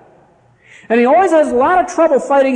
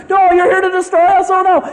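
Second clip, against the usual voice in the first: high, panicked mock plea; begging for mercy; 9 semitones higher, 4 dB louder than usual. Evil spirit begging Jesus for mercy, mock panic. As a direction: plead like a frightened demon. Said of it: "begs for mercy".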